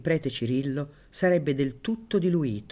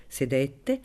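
ordinary delivery

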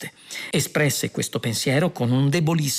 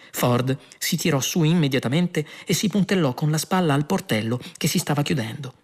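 usual speaking voice

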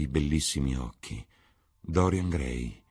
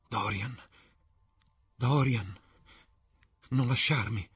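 high, unraised pleading murmur; murmured plea; 6 semitones higher than usual. A narrator's reading. Sibyl at Dorian's feet, murmuring a plea. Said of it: "mormorò".

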